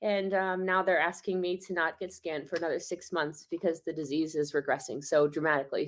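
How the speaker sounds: usual speaking voice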